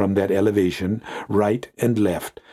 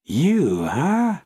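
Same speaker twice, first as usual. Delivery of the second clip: plain reading voice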